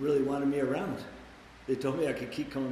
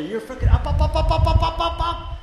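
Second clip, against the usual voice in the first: louder and higher with each up; climbing excitement; 13 semitones higher, 8 dB louder than usual. Acted. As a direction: ride the coaster up, building. Roller coaster climbing, rising pitch.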